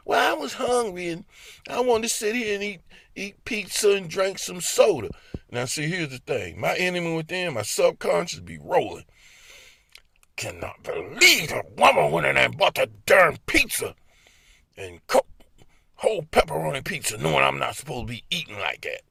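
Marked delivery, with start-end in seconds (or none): 0.1-5.1 high, whiny excuse voice; mocking wife. Husband mimicking his wife's pizza excuse, whiny. 10.4-19.0 raspy whispered grumble; hissed whisper. Pretend. His subconscious grumbling about his wife, whispered.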